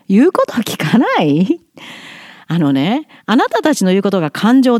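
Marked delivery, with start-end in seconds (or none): 0.1-1.6 言うこと聞かない？ as a rising, questioning echo; echoed back. Retold or imitated. 同僚の非難をそのまま問い返して、軽く上げる調子で. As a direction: toss the accusation back as a question, rise on こ. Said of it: "とか".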